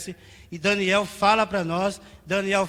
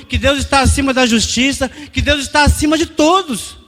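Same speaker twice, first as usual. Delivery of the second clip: higher-pitched, driving sermon voice; impassioned preaching; 5 semitones higher, 7 dB louder than usual. Real, not acted. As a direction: build the proclamation phrase by phrase. Preaches at high intensity.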